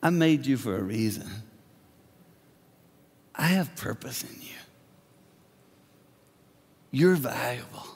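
soft, fading to a whisper; God whispering comfort; tender God. Retold or imitated. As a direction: gently assure a hurting child. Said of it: "He looks at you and he says".

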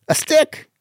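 very high squeak of a payoff; exaggerated punchline. Shown as a demonstration. Comic working on his delivery, squeaky punchline. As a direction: squeak the answer out in falsetto. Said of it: "on the delivery".

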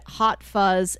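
usual speaking voice

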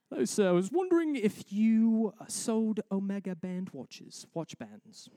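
high, loud put-on British customer; British accent. British customer asking the new clerk about watch bands, British accent. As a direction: play a British shopper, loud and high then easing off. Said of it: "every story's better with a British accent".

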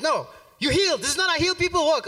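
high, loud, fast insistence; mock scolding pastor. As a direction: hurry him along like a fired-up pastor. Pastor scolding weak walk.

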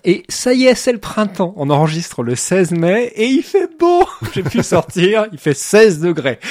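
bright, lifted, audibly smiling. Real, not acted. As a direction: talk with a big smile, nearly laughing. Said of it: "j'ai le sourire dans la voix".

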